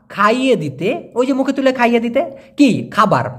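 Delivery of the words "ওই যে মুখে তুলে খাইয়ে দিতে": usual speaking voice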